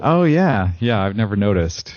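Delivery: feigned modest astonishment; mock surprise. Plays along with feigned modesty.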